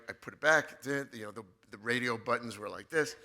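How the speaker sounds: normal talking voice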